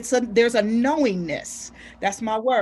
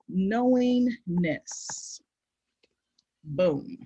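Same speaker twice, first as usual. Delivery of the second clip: slow emphatic; 4 semitones lower, 4 dB quieter, much slower than usual. Real, not acted.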